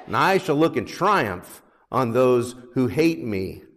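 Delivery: loud, ringing psalm reading; scripture reading. Acted. Pastor reading Psalm 118 to congregation, proclaiming. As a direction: proclaim the verses to the church.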